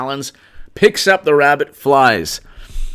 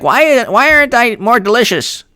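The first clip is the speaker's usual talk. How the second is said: high, mock-plaintive politician voice; Hillary; 11 semitones higher than usual. Imitating a politician wondering about herself, high mock complaint. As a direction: put on a high whine of wounded vanity. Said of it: "She's like".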